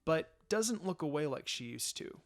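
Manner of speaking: hushed, tender storytelling; soft romantic narration. A narrator's reading. Narrator softly telling the kiss to listeners. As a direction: read it close and gentle like a love scene.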